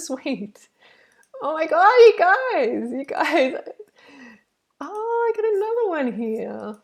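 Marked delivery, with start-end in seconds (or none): 1.4-6.8 thrilled squeal of delight at the donors; excited. Real, not acted. Excited reaction to a new donation.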